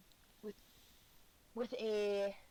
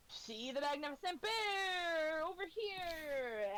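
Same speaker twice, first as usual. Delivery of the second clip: high, slow showman's cry; barker calling out; 7 semitones higher, slower than usual. Acted. Barker shouting to the crowd about the bear. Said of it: "people just yelling".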